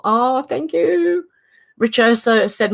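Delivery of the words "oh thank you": slow, high drawn-out thank you; touched thanks. Real, not acted. Thanking Ev for thinking of her, slow and high. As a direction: stretch it out sweetly.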